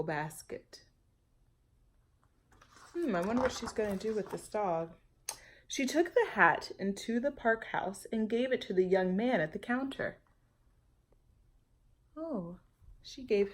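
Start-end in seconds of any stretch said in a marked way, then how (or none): none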